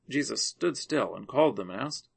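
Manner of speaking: plain reading voice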